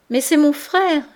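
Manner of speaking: loud, high protest; pained angry cry. A narrator's reading. Denise defending herself, angry and hurt. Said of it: "cria-t-elle avec la colère douloureuse".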